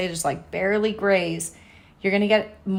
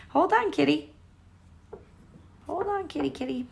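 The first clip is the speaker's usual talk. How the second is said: talking to the cat; 7 semitones higher, 4 dB quieter than usual. Real, not acted. Cat hitting her chair.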